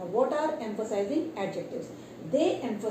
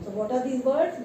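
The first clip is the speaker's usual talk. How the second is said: normal talking voice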